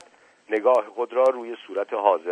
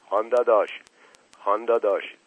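ordinary delivery

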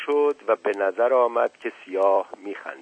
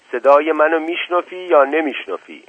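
raised, high-pitched demand; shouting; 7 semitones higher, 7 dB louder than usual. A narrator's reading. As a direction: shout it as an insistent question. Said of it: "زاهد داد زد". (با داد و اصرار)

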